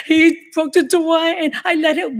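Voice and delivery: high, upset rush to the police; frantic plea. Retold or imitated. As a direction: relive the panic of explaining. Her own words to police.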